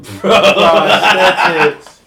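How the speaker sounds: very drawn-out, high, loud delivery; loud, goofy and laughing; hammed-up blurt. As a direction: stretch the word into a big comic yell. Word blurted out as a joke.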